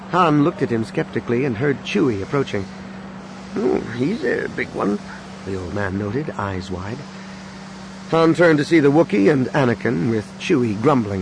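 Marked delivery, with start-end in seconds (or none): 3.6-5.0 put-on old man voice.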